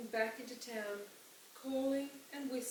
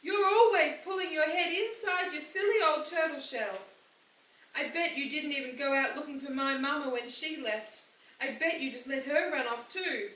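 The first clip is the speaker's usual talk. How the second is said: loud, higher accusing outburst; angry shouting; 5 semitones higher, 9 dB louder than usual. A narrator's reading. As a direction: hurl the accusations at him. Opal shouting at her father.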